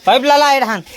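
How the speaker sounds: high, loud call across the field. Real, not acted. Shouting to the two brothers about the pipe, loud high call.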